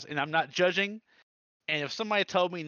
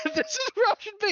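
laughing; faster than usual. Real, not acted. Laughing while quoting.

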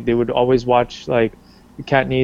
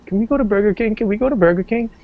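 put-on high, pleading kid voice asking again and again; begging child; 9 semitones higher, much faster than usual. Child begging his dad after church for Burger King, high and pleading. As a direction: imitate a kid nagging for fast food. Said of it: "it was just".

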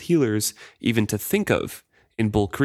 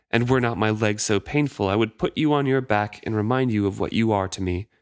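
usual speaking voice

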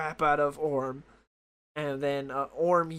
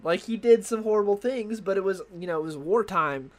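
ordinary delivery

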